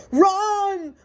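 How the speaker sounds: high, loud panicked shout; crying out. A narrator's reading. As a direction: scream the warning. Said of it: "cried Chicken Little".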